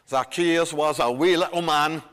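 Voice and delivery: light Scottish lilt, half sung like the Sunday school song; Scottish accent. Shown as a demonstration. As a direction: sing the line with a gentle Scottish accent. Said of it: "sing it with a Scottish accent".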